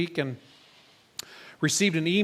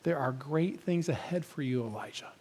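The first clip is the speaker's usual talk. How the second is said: soft, lowered voice fading very quiet on the name; hushed divine whisper; 2 semitones lower, 5 dB quieter than usual. Acted. God whispers encouragement to Elijah, hushed and fading. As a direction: gently imitate a whisper, still voiced. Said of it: "God whispered to Elijah and said".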